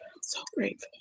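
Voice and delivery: breathy whispered thanks, barely voiced. Real, not acted. Alone with her gratitude, whispering. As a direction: whisper it as a private thank-you.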